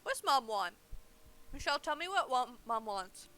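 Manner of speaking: ordinary delivery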